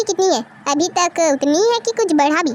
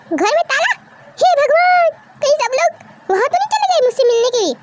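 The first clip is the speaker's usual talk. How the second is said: raised pitch, dismayed exclamation; alarmed surprise; 6 semitones higher than usual. Acted. Son finds the family house locked, alarmed.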